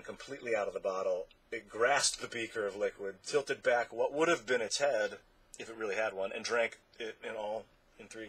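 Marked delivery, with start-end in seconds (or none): none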